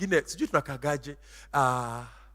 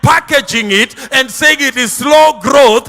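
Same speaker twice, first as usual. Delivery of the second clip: loud, rising preacher's cry over music; preaching at full force; 7 semitones higher, 14 dB louder than usual. Acted. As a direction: preach it out, half singing.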